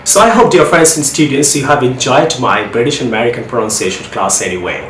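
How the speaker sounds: ordinary talk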